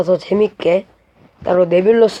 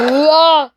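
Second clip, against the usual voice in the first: frustrated yell; 9 semitones higher, 5 dB louder, much slower than usual. Real, not acted. Game frustration.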